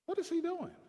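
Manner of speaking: high-pitched, puzzled exasperation; exasperated father. Retold or imitated. Father wondering about his idle son, exasperated.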